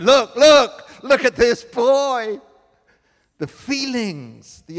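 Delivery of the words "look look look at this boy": loud, high, bursting with pride; proud excitement. Retold or imitated. New father showing his baby to the neighbor, thrilled. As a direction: show off the newborn. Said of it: "running to the neighbor's house".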